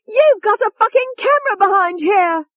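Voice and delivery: very high, loud furious scream; Tony screaming. A narrator's reading. Tony discovering the hidden camera, furious scream. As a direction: scream it as you find the trap. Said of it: "Tony screamed".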